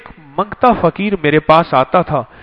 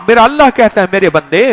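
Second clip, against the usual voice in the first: normal talking voice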